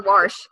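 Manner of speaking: "wash" said warsh, R before the sh; Maryland wash with R. Shown as a demonstration. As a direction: own up to "wash" with an added R. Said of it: "that's how you say it".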